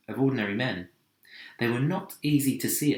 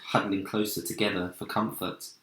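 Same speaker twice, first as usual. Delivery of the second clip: ordinary delivery, nothing marked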